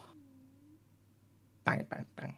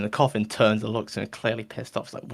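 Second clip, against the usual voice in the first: ordinary talk, nothing marked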